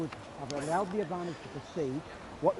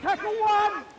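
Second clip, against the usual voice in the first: calling from far away; 8 dB louder, much slower than usual. Real, not acted. Referee calling out on field.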